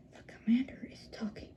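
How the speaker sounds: hushed unvoiced whisper; whispered shush. A narrator's reading. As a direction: breathe it, no voice at all. Hushing the crowd.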